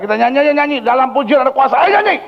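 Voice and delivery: high-pitched retold urging to sing; rally the frightened group, pitch way up; urgent rallying call. Retold urgent call to start singing. (ajakan bernyanyi yang mendesak, nada tinggi)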